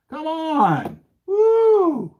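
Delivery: high, loud hammed-up whoop; mock cheer. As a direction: whoop it up like a game show. Cheering the prop bomb drop.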